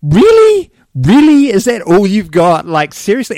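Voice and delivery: high, loud incredulous mockery; mock outrage. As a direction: scoff at him in disbelief. Mocking the vegetarian lawyer.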